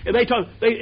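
normal talking voice

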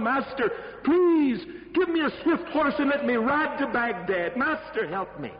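a servant who has just seen Death, pleading in terror; frightened servant. Acted. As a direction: beg the master to let you flee. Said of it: "He said".

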